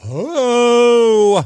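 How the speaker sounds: high, loud held cry; cut-off mock scream. Host starting a loud high cartoon yell, then stopping. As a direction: start to belt the Thundercats yell. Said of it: "I don't wanna scream at the top of my lungs".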